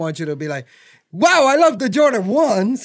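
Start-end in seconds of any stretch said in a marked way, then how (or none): 1.1-2.8 loud, high, drawn-out mock gushing; over-enthusiastic. Job seeker gushing to a sneaker store interviewer, over-enthusiastic. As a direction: gush like an overeager sneaker fan. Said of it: "Don't be too enthusiastic".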